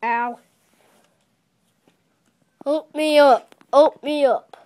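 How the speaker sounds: loud plea for help; calling for help. Pretend. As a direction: shout for someone to help. Fallen train calling for help.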